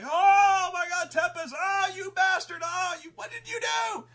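hammed-up high, loud wail; mock shriek of outrage, very high; horrified crowd. Crowd to Tempest after the killing, horrified shouting. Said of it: "like, rightfully horrified".

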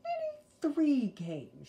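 slow sly little sister; hard bargain. A narrator's reading. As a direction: bargain slyly. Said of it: "driving a hard bargain".

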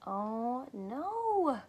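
usual speaking voice

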